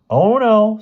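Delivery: high, loud sudden dismay; sudden alarm. A narrator's reading. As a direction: realise the problem suddenly. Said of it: "said Bruno suddenly".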